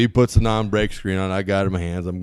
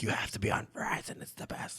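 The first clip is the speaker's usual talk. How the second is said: softer, airy quoting of the ex; breathy girlfriend quote; 14 dB quieter than usual. Retold or imitated. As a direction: whisper her line in mock insistence. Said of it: "She's like".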